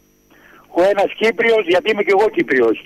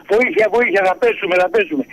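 ordinary talk

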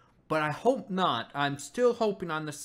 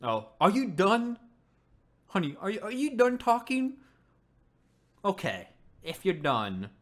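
sarcastic; 5 semitones higher than usual. Real, not acted. Sarcastic to ranting character.

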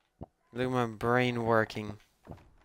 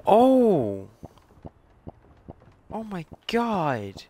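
awed gasp; 10 semitones higher, much slower than usual. Real, not acted. Finding gramophone, awed gasp.

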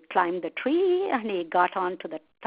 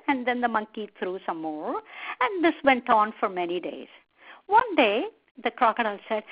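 usual speaking voice

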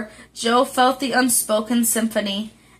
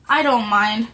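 ordinary delivery, nothing marked

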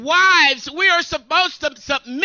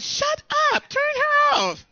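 higher, comic exasperated shout; mock exasperated shut up; 12 semitones higher than usual. Preacher retelling yelling at the navigator, higher comic snap. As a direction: snap at the nagging GPS. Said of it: "I'm going like".

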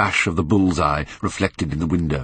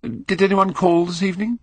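Dorian voice; 12 semitones higher than usual. Acted. Dorian questions valet.

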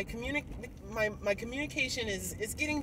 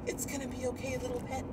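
softer soothing mock husband; coddling husband; 3 semitones higher, 6 dB quieter, faster than usual. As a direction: coo to a pet. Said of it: "him just coddle me".

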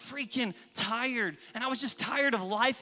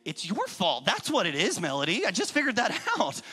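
joking blame, building louder and higher; hammed-up indignant discovery, ending raised; mock accusation; 3 semitones higher, 5 dB louder than usual. Husband teasingly blaming wife Melody, mock-angry accusation. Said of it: "I'm just kidding".

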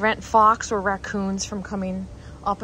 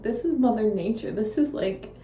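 ordinary talk

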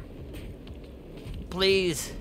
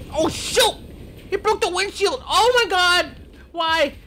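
shocked; 6 dB louder than usual. Real, not acted. Plane crashes in game.

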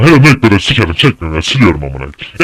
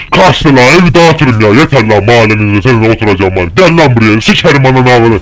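loud, raised-pitch shouting at an opponent; angry challenge; 4 semitones higher, 5 dB louder than usual. Real, not acted. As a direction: call them out in a rage. Taunting game enemy to come.

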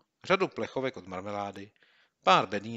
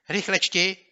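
much higher and louder, impatient; impatient urging; 11 semitones higher, 10 dB louder, slower than usual. Acted. Boy pestering his friend to read, impatient. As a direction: hurry him along.

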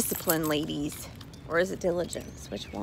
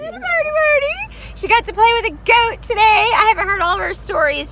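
sing-song cooing; 13 semitones higher, 14 dB louder than usual. Real, not acted. Mom gushing over daughter Birdie in high sing-song. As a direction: croon her pet name up high.

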